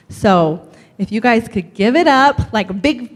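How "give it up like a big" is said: hyped introduction. Real, not acted. Host bringing a guest onstage, building to an excited shout. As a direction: build to a loud, high cheer for the guest.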